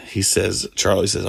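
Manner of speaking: ordinary talk, nothing marked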